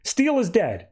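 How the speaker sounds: high, forceful call to shut up; Flash cutting in. Retold or imitated. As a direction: silence the bickering room. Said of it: "Flash comes in and says".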